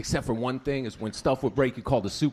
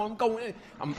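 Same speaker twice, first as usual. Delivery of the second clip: ordinary delivery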